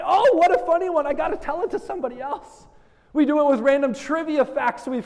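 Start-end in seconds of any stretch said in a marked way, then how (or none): 0.0-2.7 amused excitement. Retold or imitated. Sharing a dumb joke.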